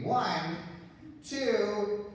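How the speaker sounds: ordinary delivery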